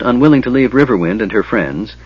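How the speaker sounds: usual speaking voice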